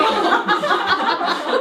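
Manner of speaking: high loud burst of laughter at the memory. Real, not acted. Recalling being asked why she protests, bursting into loud laughter. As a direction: laugh out loud, caught off guard. Stunned by the question.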